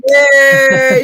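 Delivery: loud, high whoop of joy; cheer. Real, not acted. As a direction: cheer like at a parade. Cheering the shout-out.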